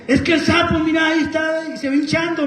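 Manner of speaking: high, urgent mock alarm; imitated alarmed mother. Mother showing her son the swelling toad, imitated alarm. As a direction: play mom pointing out the scary toad. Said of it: "Y me dice".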